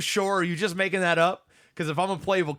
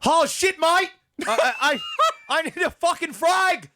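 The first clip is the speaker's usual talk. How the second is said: hammed-up Australian soldier; 11 semitones higher than usual. Asked by his co-host for a frag-out line, Aussie accent shouted high. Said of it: "use that accent and give me a frag out".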